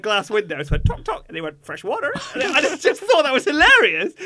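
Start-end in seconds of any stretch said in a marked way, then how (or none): 0.8-1.2 high clicking tok tok; beak tapping noise. Retold or imitated. Imitating beak on glass. 1.6-2.1 high laughing parrot imitation. 2.3-4.1 high loud laugh through words; giggling as you speak. Real, not acted. Laughing retelling.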